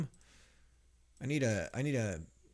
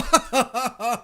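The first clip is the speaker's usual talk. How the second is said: high burst of laughter; delighted laugh; 13 semitones higher, 15 dB louder than usual. Real, not acted. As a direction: laugh out, thrilled. Reacting to glowing visuals.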